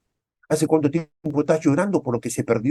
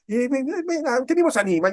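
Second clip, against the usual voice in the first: self cheering; 12 semitones higher than usual. Retold or imitated. Cheering himself on.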